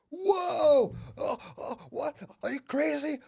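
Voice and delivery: high, loud startled outburst; panicked attacker. Retold or imitated. Attacker recoiling from the offered head, high-pitched panic. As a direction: imitate a man freaking out, pitch shooting up. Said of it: "I just hear him go".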